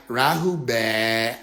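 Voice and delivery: low, very slow intonation; mock greeting. Woman greeting the aliens with Rahu bad, low slow drawl. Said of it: "came in and was like".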